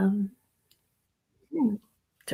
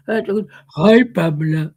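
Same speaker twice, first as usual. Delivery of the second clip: dropped noticeably lower for the greeting; trying out a lower voice; 6 semitones lower, much slower than usual. Shown as a demonstration. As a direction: say hi in a deeper register. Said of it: "lower my voice".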